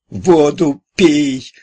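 high, loud and very slow shriek. A narrator's reading. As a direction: screech the order in a panic. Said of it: "завизжал заведующий".

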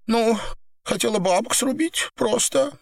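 high, small, halting voice; upset admission. Acted. Woman admits motive to captor, high halting confession.

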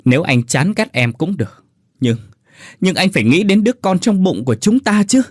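rising, high desperate plea; wife imploring husband; earnest pleading. Acted. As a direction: implore him for the baby. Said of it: "rồi khẩn khoản".

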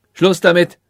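impatient royal order; curt command. A narrator's reading. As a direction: cut the pleader off with authority. King cuts off the sage.

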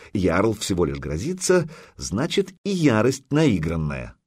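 usual speaking voice

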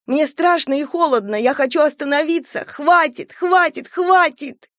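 high shrill-rising pleas to stop; terrified screaming. Acted. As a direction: scream it out, begging it to end. Terrified girl screams at unseen force.

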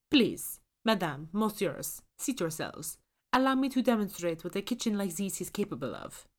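seat the guests with French flourish; French host accent. A narrator's reading. Ghost chef invites Susan to sit, courtly French accent. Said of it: "The chef's accent is thickly French".

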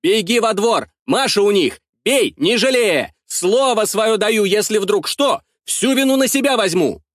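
urgent commands. A narrator's reading. Urgent orders to Petro.

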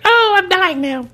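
very high squeaky dying whine; tiny creature voice. Retold or imitated. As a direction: squeak it like a little alien. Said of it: "he's like".

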